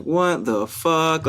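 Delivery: very slow, stretched words; slow-motion incredulous reaction; drawn-out disbelief. Retold or imitated. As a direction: drag out each word in dismay. Acts own disbelief at Trump era.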